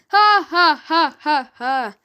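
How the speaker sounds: loud ringing laughs; laughter. A narrator's reading. Court laughing at the vicar, loud laughter. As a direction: laugh out, then fade.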